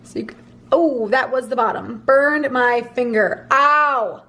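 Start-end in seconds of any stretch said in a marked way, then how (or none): 3.5-4.2 sudden loud ow from a burn; sharp pain yelp. Real, not acted. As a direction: yelp as the iron catches your finger. Burns finger on curling iron.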